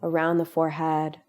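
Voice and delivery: calm, unhurried meditation voice with long pauses; guided meditation. Real, not acted. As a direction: guide them gently into relaxation, leaving space between phrases. Leads a guided relaxation meditation.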